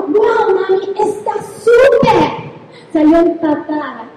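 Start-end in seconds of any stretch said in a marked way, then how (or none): 0.0-2.5 higher, slow, laughing sing-song delight; little girl half-laughing with joy; excited daughter. Retold or imitated. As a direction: sing it out like a thrilled kid. Other daughter thrilled after jumping.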